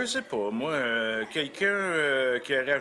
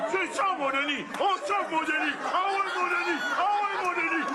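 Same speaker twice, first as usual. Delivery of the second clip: much higher shouting, overjoyed; ecstatic cheering. Acted. Coach cheering his friend through the birth, high joyful shouts. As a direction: cheer the baby out at the top of your voice.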